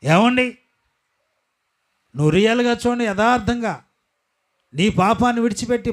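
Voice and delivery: high, loud, emphatic pulpit voice; full-force preaching. Acted. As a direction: drive each phrase home.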